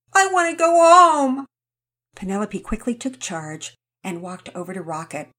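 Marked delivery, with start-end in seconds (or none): 0.1-1.5 high, loud whiny plea; upset child. Acted. Rocket tells the kids he wants to go home, whining. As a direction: whine like a tired kid.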